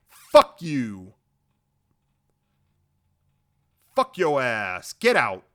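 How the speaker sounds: loud, punchy mock outrage; angry refusal. Obi-Wan rejects Dooku's offer to join him, loud mock-angry refusal. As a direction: throw each phrase out loudly, dismissing him.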